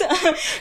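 short high embarrassed laugh; a quick giggle, pitched high; bursting laugh. Real, not acted. Her own laugh after admitting the crush.

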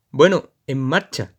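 fuerte y lento; grítale que arranque; grito del jorobado. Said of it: "le grita el jorobado a Jonah". (el jorobado le grita a Jonah)

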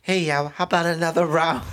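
high, put-on British pub-goer; jokey British pub voice; mock British pub accent.

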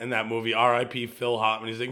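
normal talking voice

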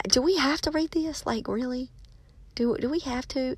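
reluctant, incredulous complaint. Retold or imitated. Reader protesting cursing on every page, soft mock complaint. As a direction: plead softly, as a put-off reader. Retold weary complaint about a book.

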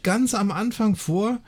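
usual speaking voice

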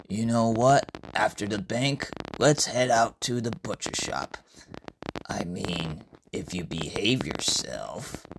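low, measured character voice; Iridis, indulgent. Acted. Iridis offering Usuke a trip to the butcher, low and indulgent.

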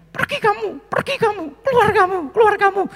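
slurred, very high yell telling him to get out; stroke patient yelling. Retold or imitated. Paralysed old man yells at the visiting pastor, high and strained.

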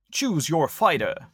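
slow announcer call; fighting game announcer. Pretend. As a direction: boom it out like an arcade game. Street Fighter style game intro.

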